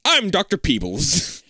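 slow, loud put-on scientist voice; eccentric Doctor Peebles. Scientist introducing himself to visitor, grand. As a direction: announce yourself grandly in a character voice.

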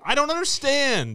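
high-pitched exasperated bafflement. Real, not acted. Host baffled by candy stacked in pyramids, exasperated. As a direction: protest it high, totally baffled.

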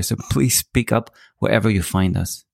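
ordinary delivery

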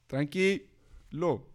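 drawn-out, gentle tranquilo; slow soothing. Pretend. The dog comforting the crying girl, very slow and soothing. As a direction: soothe her very slowly.

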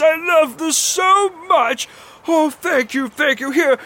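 high, loud gushing thanks; wide-eyed delight. Acted. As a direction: cry out in amazed, overjoyed gratitude. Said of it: "Odysseus is just wide-eyed, just going".

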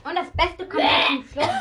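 high, loud hype for the finale; loud showy announcement. Real, not acted. Kid telling viewers the best comes last, loud showy announcement. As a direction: announce it big and high, like a showman.

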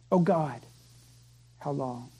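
a hushed, aching plea from the knees; anguished plea. Retold or imitated. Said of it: "you cry out".

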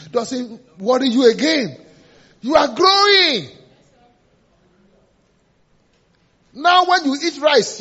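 high, loud, drawn-out proclamation; exultant preaching shout. Real, not acted. Preacher telling congregation they are growing, exultant shout. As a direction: stretch each phrase into a shout. Preacher exclaims about maturing.

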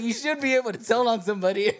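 high-pitched quote through laughter; laughing mock protest. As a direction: imitate the indignant friend, cracking up. Retelling friend's protest while laughing.

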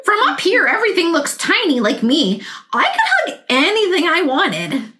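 high, amazed little dinosaur voice; delighted wonder. Acted. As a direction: marvel at the view from the sky. Tiny T-Rex flying high.

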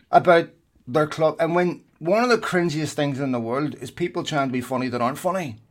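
normal talking voice

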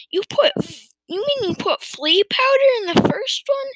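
very high-pitched disbelief; incredulous question. A narrator's reading. Farmer learning of the flea powder, incredulously asking. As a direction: repeat it back in astonishment.

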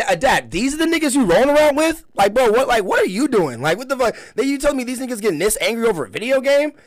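high-pitched, baffled scolding; exasperated can't-believe-this quote; incredulous questioning. Retold or imitated. Acts baffled quote to Adapt.

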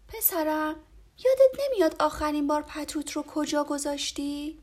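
lightly voiced mom coaxing her son; gentle mother. A narrator's reading. Mother asks her son where he left it. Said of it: "مادر گفت".

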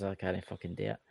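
ordinary talk, nothing marked

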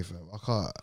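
ordinary delivery

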